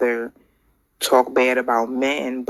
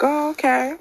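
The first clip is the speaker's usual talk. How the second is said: much higher, mock surprised oh okay; 14 semitones higher than usual. Retelling her surprised reply to the young man. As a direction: act out being caught off guard. Her retold reaction to his words.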